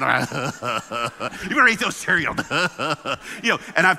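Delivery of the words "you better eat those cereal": laughing dad. Retold or imitated.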